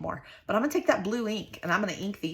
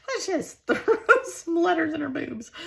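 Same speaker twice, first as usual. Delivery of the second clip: 1 laughing; 9 semitones higher, 4 dB louder than usual. Real, not acted. Still giggling at the joke.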